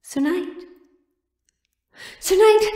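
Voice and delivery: from a hushed call rising to a loud, high shout. Acted. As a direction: search for him, then cry out his name. Calling for the jailed knight.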